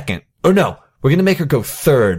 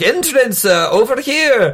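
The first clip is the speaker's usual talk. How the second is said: high, drawn-out crier drumming up a crowd; old-timey carnival barker; 11 semitones higher than usual. Acted. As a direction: hawk the show like an old-time barker. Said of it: "like an old-timey carnival barker".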